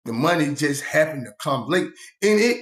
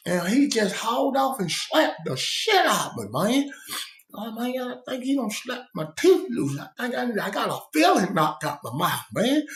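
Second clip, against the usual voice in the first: higher, aggrieved inmate voice; higher complaining voice of the slapped cellmate; whiny retold cellmate; 8 semitones higher than usual. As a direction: play the wronged cellmate telling his tale. Voicing Swamp Dick's story.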